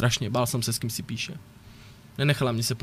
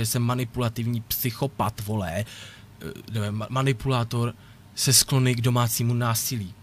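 ordinary talk, nothing marked

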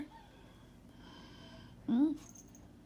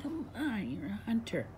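normal talking voice

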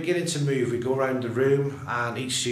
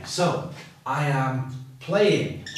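"I am playing" said slowly with "playing" stressed and falling; teacher modelling the answer slowly; slow model sentence; much slower than usual. Shown as a demonstration.

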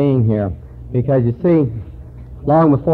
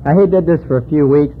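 ordinary talk, nothing marked

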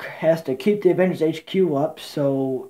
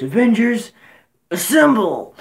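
hammed-up rallying shout, higher and louder; battle cry; 5 semitones higher, 5 dB louder than usual. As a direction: call the team to charge. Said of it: "and he goes".

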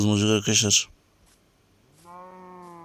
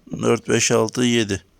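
ordinary talk, nothing marked

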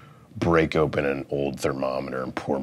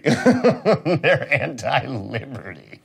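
slow, laughing delivery; 8 semitones higher, 5 dB louder, much slower than usual. Real, not acted. Comic laughs at his own liberty bit, slowly. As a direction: giggle the words out slowly.

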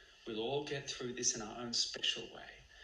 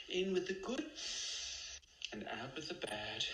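guided meditation; 2 semitones higher, 5 dB quieter than usual. Acted. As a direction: guide each breath calmly.

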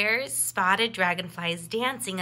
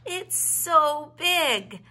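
slow, louder, amazed; awed; 6 semitones higher, 6 dB louder, much slower than usual. A narrator's reading. Little bear in awe of the big waterfall. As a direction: stretch it out in awe.

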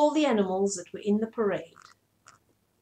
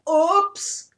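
high, loud startled oops; cried oops; 8 semitones higher, 9 dB louder than usual. Acted. Woman slipping in the mud, loud startled cry. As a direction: slip and yelp. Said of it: "cried the woman".

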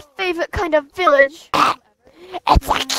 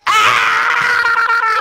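long high-pitched wail; one drawn-out, high cry; sea-turtle scream; 8 semitones higher than usual. Real, not acted. Stabbed player cries out to friend, high wail. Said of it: "sound like an aquatic sea turtle".

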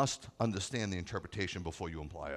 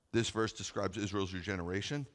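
ordinary talk

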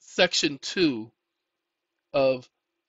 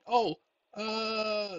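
usual speaking voice

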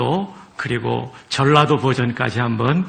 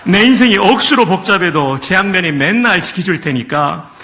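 louder, higher Gyeongsang dialect read with chuckles; 5 semitones higher, 3 dB louder than usual. Pretend. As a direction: read Psalm 23 as a hearty Gyeongsang local. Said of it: "한번 경상도". (경상도 사투리로 신나게 읽기)

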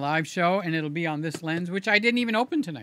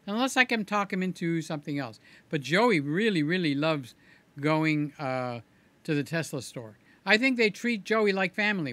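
ordinary delivery

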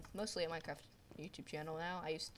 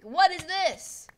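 slow, drawn-out, much higher and louder cry; stunned as the shot lands; shocked yell; 12 semitones higher, 15 dB louder, much slower than usual. Real, not acted.

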